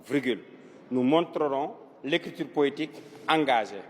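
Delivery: low, measured dictation with pauses between chunks. Shown as a demonstration. Teacher dictating an essay plan to pupils, measured dictation. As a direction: dictate it phrase by phrase, pitched low.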